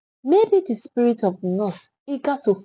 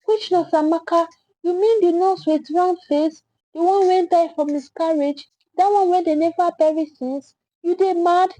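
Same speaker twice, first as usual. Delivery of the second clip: incredulous; 7 semitones higher than usual. A narrator's reading. Crowd member disbelieving Pidgin.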